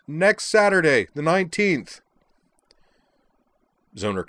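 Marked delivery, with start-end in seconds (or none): 0.1-2.0 high, loud, slowing call; yelled answer. A narrator's reading. Azalin calls the date back to Zoner, yelling. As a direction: holler it so it carries. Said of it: "turned around and yelled".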